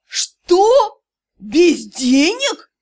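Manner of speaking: a high, loud «Что?» then a slow stunned «Без денег?»; shocked. Acted. Yurao learns that Dei works for free, shocked. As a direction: gasp it out in disbelief.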